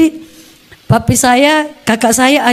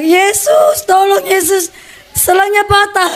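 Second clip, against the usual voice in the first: retold cry for help; 8 semitones higher, slower than usual. Beaten child calling on Yesus for help, imitated cry. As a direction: call out high, re-enacting a desperate plea. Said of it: "Pas saya teriak".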